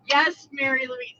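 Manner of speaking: ordinary delivery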